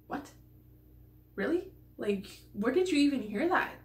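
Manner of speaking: incredulous, pitch jumping up on Really; mock stunned reaction; retold shocked what-really; baffled disbelief. Retelling baffled reaction to strange comment.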